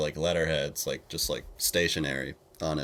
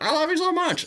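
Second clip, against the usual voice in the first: much higher fawning fan voice; gushing fan; 4 dB louder than usual. Retold or imitated. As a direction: squeal like a starstruck fan. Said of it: "the guy that was like".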